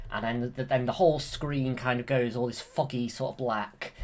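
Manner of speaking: ordinary delivery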